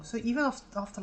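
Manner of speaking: ordinary talk, nothing marked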